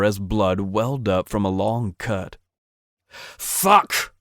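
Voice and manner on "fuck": sudden high yelp of pain; sharp, high yelled curse. Acted. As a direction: cry out as the wire cuts. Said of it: "he yelled".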